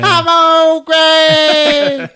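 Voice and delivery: high, drawn-out crazed yell; hammed-up maniac cry, very slow; Old Greg. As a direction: announce yourself in a loud, stretched screech. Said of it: "And he's laughing".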